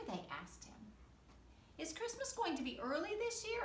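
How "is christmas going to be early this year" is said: high, eager little fox voice. Acted. Fox asking Santa about Christmas, high little voice. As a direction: ask it like a small worried fox, up high. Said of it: "asked the fox".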